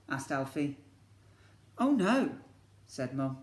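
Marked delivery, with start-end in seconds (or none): 1.8-2.4 higher, brighter mum voice; Mum gently refusing. Acted. Mum tells Alfie she won't stay, kind refusal. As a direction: let him down kindly as Mum.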